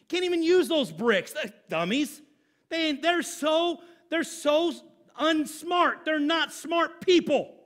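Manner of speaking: mock them with scorn; mocking critics. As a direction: critics jeering at the builders.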